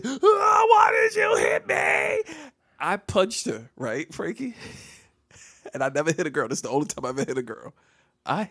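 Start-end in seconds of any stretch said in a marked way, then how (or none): 0.0-2.3 very high, drawn-out mock wail; tearful scream. Boy charges at the girl fighting tears, high wailing scream. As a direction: scream it high, slow and loud, near tears. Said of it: "And I'm screaming".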